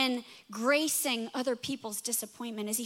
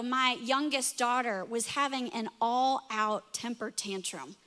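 ordinary talk

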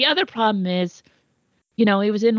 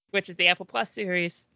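usual speaking voice

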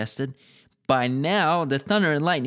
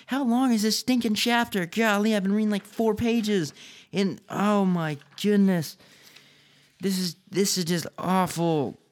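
real fed-up whine, pitched up; exasperated reader; 6 semitones higher than usual. Real, not acted. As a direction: break from reading to complain, dragging the words. Reader moans about the long chapter.

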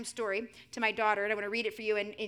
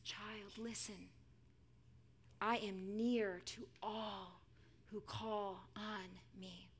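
soft, very slow comfort; hushed, spaced-out promise; tender reassurance; 9 dB quieter, much slower than usual. Acted. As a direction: speak intimately to a weary child. Jesus tenderly addressing a weary child.

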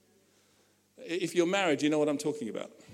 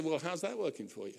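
dry retort; 3 semitones lower, 10 dB quieter than usual. Retold or imitated. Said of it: "And I say".

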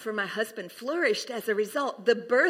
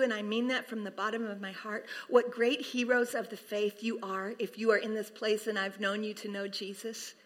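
ordinary delivery

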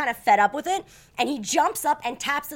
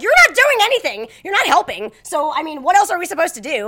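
higher, louder indignant retort; angry; 6 semitones higher, 9 dB louder than usual. Retold or imitated. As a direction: throw it back at him, fed up. Arguing back at the attendant.

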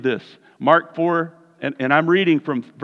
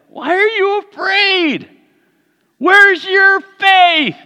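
slow, high, loud questioning; slow pleading question; 6 dB louder, much slower than usual. Retold or imitated. Jesus asking his frightened disciples, slow and high. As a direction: draw out each word in wonder. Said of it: "Then he asked them".

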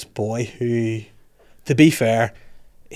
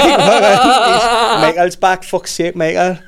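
high, loud comic Geordie alarm; laughing through a Geordie impression; Geordie accent; 8 semitones higher, 7 dB louder, much slower than usual. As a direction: exclaim it in hammed-up Geordie. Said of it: "but with a Geordie accent".

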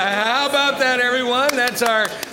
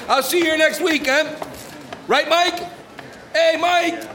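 calling out; 12 semitones higher, 4 dB louder, slower than usual. Acted. Calling after departing friend.

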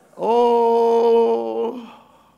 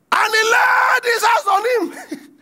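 mock shout; 10 semitones higher, 3 dB louder than usual. Acting the servant seizing debtor.

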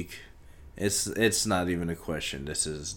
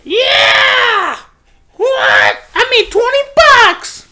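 very high, very loud cries of joy; excited shouting; 15 dB louder, much slower than usual. Real, not acted. Host thrilled about twenty bucks, excited shouting.

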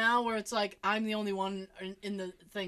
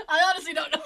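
laughing; 8 semitones higher, 8 dB louder, faster than usual. Real, not acted. Laughing helplessly at own joke.

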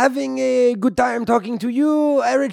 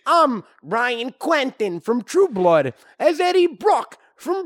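put-on terrible Brooklyn accent; 4 semitones higher than usual. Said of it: "a terrible Brooklyn accent".

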